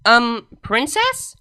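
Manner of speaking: slow, unsure question; a worried student approaching her mentor; hesitant. A narrator's reading. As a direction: tiptoe into it nervously.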